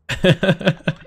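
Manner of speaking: high, breathy nervous giggle; short anxious laugh. Real, not acted. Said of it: "You can tell by my nervous laugh".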